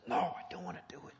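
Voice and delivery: high, put-on balking refusal; reluctant inner voice. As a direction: dig in against doing it. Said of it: "something in us just says".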